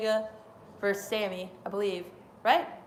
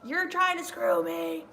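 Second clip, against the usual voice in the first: higher, slow mock complaint; a hint of Bret Hart; 8 semitones higher, slower than usual. Retelling the promo, lightly voiced complaint. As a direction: lightly mimic the wrestler. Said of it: "He was like".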